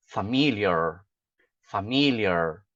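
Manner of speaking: FAMILIAR as fuh-MIL-yer with curled final R; English pronunciation. Shown as a demonstration. Teacher answering how to say it in English, familiar as fuh-MIL-yer. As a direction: stress MIL and hold an American "er" ending in "familiar". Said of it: "o que que eu falo?".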